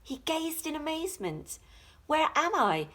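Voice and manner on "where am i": amazed question. A narrator's reading. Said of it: "he said".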